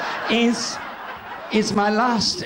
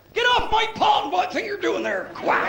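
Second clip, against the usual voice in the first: very high, indignant duck voice; Devonshire wild duck; 13 semitones higher than usual. Pretend. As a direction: squawk it like a territorial duck. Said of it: "The rare but beautiful Devonshire wild duck".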